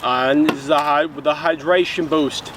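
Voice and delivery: a light put-on Australian accent, hammed-up; goofy mild Australian accent for a drink review; mock Australian accent. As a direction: play a jokey Aussie reviewer with a half-hearted Australian accent. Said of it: "my Mad Lib Australian accent".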